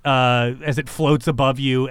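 usual speaking voice

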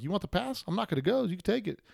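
mock breezy offer. Retold own casual offer.

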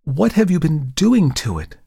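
a startled model exclaiming. A narrator's reading. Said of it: "she exclaimed".

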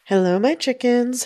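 ordinary delivery

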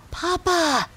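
put-on high-pitched little child voice; child's urgent whisper. A narrator's reading. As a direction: call Papa in a high, hushed child's voice. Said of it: "he whispered".

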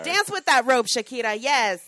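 loud, whooping encouragement; cheering. Real, not acted. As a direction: cheer her on loudly. Cheering the performer on.

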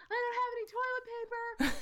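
put-on high, loud whiny child; whiny kid voice. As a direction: whine it like a stranded little kid. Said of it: "another kid who's like".

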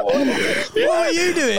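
high-pitched, drawn-out laughing question; laughing disbelief. Real, not acted. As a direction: squeal it through real laughter. Listener cracking up at the story.

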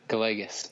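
Gallegos the family's anglicized way; family's own pronunciation. Shown as a demonstration. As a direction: say Gallegos plainly as an American surname, no Spanish Y. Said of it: "we pronounce it".

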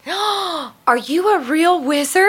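put-on high, slow, wonder-struck child's question; excited little kid. Six-year-old nephew asks the store clerk, really excited. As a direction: gasp it out like an amazed kid. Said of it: "nephew got really excited when he got".

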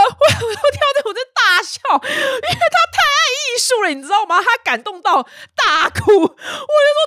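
can't stop laughing while telling it; real laughter. Real, not acted.

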